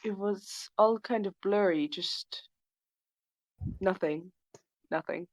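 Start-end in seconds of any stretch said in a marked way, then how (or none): none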